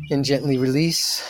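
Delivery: slow, calm meditation voice; soothing guide talking a group through breathing; guided meditation. Acted. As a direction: ease them into stillness, unhurried.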